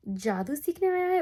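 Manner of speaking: high, slow mocking question; condescending. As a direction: imitate a bully talking down to the new kid. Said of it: "in a really condescending tone".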